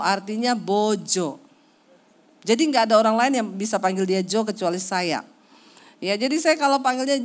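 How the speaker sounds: usual speaking voice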